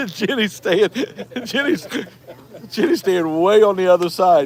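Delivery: real laughter while teasing; laughing tease. Real, not acted. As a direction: giggle while calling her out.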